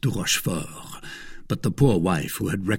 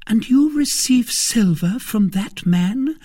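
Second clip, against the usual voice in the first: high, slow, louder question; appalled wife; 14 semitones higher, 5 dB louder, slower than usual. Acted. Wife reproaching husband for taking silver, appalled. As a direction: reproach him in disbelief.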